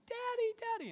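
put-on very high squealed child voice; excited children. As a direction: mimic little kids running to greet Dad. Children running to greet dad.

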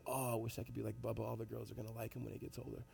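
soft, low, wistful imitation; hushed and dreamy; admiring kids. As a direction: voice awestruck kids under your breath. Admiring kids voiced in the story.

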